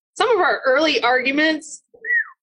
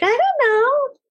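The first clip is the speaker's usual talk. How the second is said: clueless voice; 13 semitones higher, slower than usual. Retold or imitated. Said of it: "and they'd be like".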